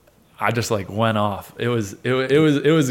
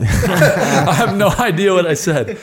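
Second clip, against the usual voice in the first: higher, louder, laughing through the words; amused disbelief; 7 semitones higher, 6 dB louder, much slower than usual. Real, not acted. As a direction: burst out laughing at yourself. Laughs at his own blackout.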